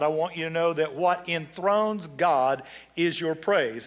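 normal talking voice

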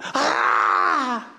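high, loud startled cry of the crowd; crowd gasp. Retold or imitated. Whole room reacting to the man on the floor, startled cry. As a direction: shout a shocked "Ah!" the way the room did. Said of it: "everybody in that room went".

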